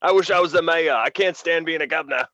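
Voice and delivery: high, loud hammed-up English accent; mock angry English. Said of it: "They're just, like, all mad".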